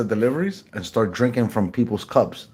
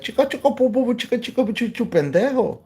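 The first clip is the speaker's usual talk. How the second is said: high-pitched gibberish Spanish imitation; cartoonish high Spanish babble; mock Spanish chatter; 13 semitones higher, faster than usual. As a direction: rattle off nonsense Spanish way up high. Mimics rapid Spanish back-talk.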